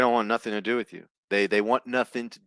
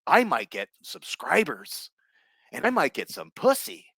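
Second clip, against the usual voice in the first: mocking nerd voice; 6 semitones higher than usual.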